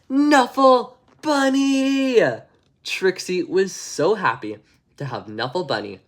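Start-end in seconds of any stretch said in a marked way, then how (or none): 0.1-2.2 high, slow, loud delighted cry of the toy's name; joyful cry. Acted. Trixie spots her lost bunny in her seat, delighted slow high cry. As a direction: call out the name in pure joy.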